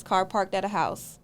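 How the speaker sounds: normal talking voice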